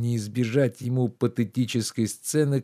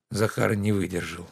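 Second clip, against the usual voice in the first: usual speaking voice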